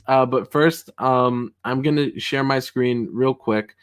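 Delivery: ordinary delivery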